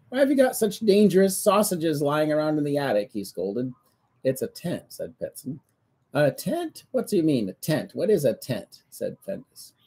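lively read-aloud storytelling; story narration. A narrator's reading. Reading the Findus book to children, lively storybook voice. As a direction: read the book to a child, playing every part.

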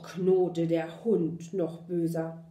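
usual speaking voice

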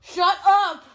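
loud high shout at friends; angry shout. Real, not acted. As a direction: snap it out in annoyance. Yelling at noisy friends.

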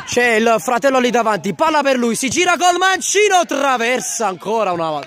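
surging play-by-play call; excited commentary. Real, not acted. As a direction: pitch climbing high on the shot. Second crossbar chance.

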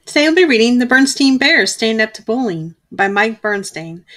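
plain reading voice

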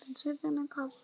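soft unvoiced whisper. Real, not acted. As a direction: breathe the words under your breath. Whispering to self in game.

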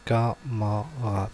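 camarade slowly split as ca, ma, rade; syllable by syllable. Shown as a demonstration. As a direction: say camarade in three slow, separate syllables. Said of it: "première syllabe".

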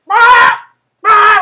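very loud, high imitated goose scream; goose attack screech. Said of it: "That thing gets up like".

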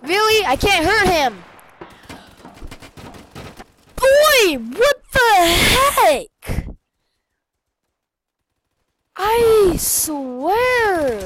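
loud, high, exasperated shouting; furious outburst. Real, not acted. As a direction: yell at the game in frustration. Can't hurt the hacker.

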